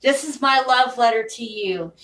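usual speaking voice

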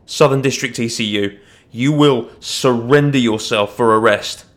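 loud, emphatic order to surrender; commanding call. A narrator's reading. Watchman ordering a fugitive to surrender for arrest, commanding call. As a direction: call it out with authority, pushing the key words.

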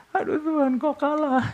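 high, loud whining plea; Joshua crying to God. Retold or imitated. Joshua complaining to God after losing, high whiny wail. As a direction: wail up at God like a beaten man. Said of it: "Joshua kan nangis".

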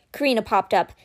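plain reading voice

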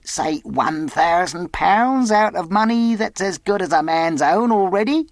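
high-pitched, insistent sailor; bargaining castaway. Acted. Castaway sounds Jim out about a thousand pounds, angling. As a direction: haggle in a high seadog character voice.

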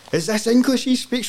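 high-pitched imitation of puzzled locals; high, incredulous mock question; imitating baffled listeners. Voices amused Tanzanians hearing him.

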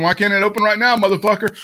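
normal talking voice

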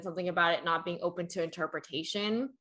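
ordinary delivery

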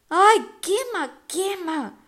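high, slow yelps of burning pain; pained cry. A narrator's reading. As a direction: cry out as if burned. Grover burned by whip.

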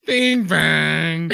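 loud, punchy bing bang; loud sound effect. Acted.

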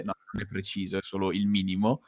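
ordinary talk, nothing marked

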